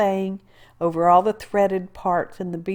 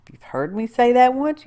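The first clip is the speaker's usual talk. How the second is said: ordinary delivery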